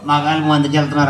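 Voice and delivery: low, quick puppet voice; puppet character. Acted. Puppet butting in on the ventriloquist, low puppet voice.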